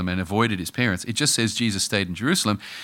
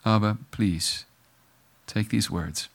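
closing prayer; 3 semitones lower than usual. Acted. Pastor leading the congregation in closing prayer, hushed.

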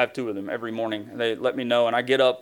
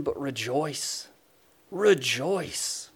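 soft tender rejoice; 3 dB quieter, much slower than usual. Acted. God tenderly urging rejoicing.

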